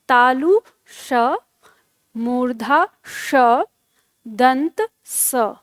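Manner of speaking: तालु श, मूर्धा श, दंत स, slowly and separately; three sibilants demonstrated. Shown as a demonstration. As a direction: pause between names, contrasting palatal श, retroflex श, dental स. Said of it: "इस तरह से हम इसका उच्चारण".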